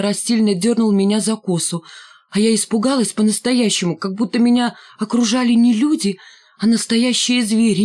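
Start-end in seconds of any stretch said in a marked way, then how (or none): none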